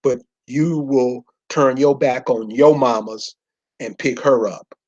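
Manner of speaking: usual speaking voice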